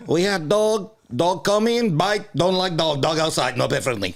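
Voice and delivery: put-on high, loud accented landlord; slow, emphatic mock Armenian-Russian accent. Imitating a landlord refusing dogs, loud mock accent. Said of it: "our Armenian and Russian listeners".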